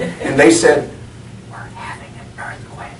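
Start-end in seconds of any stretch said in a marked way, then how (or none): 1.5-2.9 calm whispered answer. Retold or imitated. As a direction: whisper it back matter-of-factly. People answer the whispering wife.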